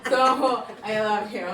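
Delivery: loud, high laughter through the words; laughing. Real, not acted. Host lightening a tearful moment, laughing.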